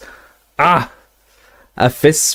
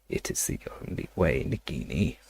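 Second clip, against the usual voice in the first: hushed whisper to the snake; 5 semitones lower, 16 dB quieter than usual. Acted. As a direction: breathe it out in a whisper. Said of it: "he whispered".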